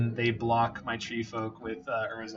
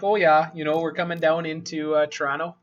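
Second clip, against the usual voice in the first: put-on Northern Ontario accent; 3 semitones higher, 5 dB louder than usual. A hint of Northern Ontario accent describing hunting up north. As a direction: imitate a friendly Northern Ontario local. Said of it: "Northern Ontario, quote unquote".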